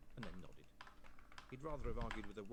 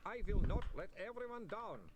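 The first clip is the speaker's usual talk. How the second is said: high, slow mild scream of resolve; a vampire shouting to resist his thirst; mock scream; 11 semitones higher, 5 dB louder, slower than usual. A narrator's reading. Otto resisting temptation.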